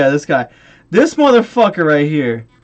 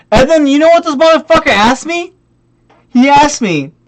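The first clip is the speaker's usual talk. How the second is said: loud, raised-pitch indignation; worked-up, disbelieving rant; incensed; 5 semitones higher, 5 dB louder than usual. Real, not acted. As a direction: build outrage before the reveal. Incensed lead-up to next question.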